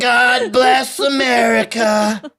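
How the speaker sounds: very slow, high, loud Trump shout; mock-patriotic Trump bellow; shouted sign-off. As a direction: belt it out like a rally closer. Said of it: "Every time I do this guy's voice".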